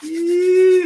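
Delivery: high, loud "Yee!" of delight; excited whoop. Real, not acted. Celebrating a viewer's son.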